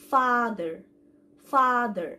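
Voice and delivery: ফাদার with a long open first vowel; model pronunciation. Shown as a demonstration. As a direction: say ফাদার slowly and loud, holding the 'aa'. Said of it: "এই ওয়ার্ডটি থেকে আমরা উচ্চারণটা". (ফাদার, লম্বা খোলা আ দিয়ে জোরে উচ্চারণ)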